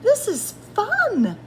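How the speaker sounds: high, delighted realisation; light up with sudden glee; delighted discovery. A narrator's reading. Bird finds the game fun, delighted.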